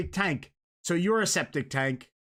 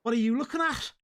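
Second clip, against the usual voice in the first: higher, put-on Scouse challenge; Liverpool accent; 7 semitones higher, faster than usual. Shown as a demonstration. Showing a friend how Liverpool talks, crazy Scouse. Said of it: "Liverpool, which have that really crazy".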